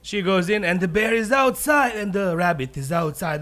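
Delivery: ordinary talk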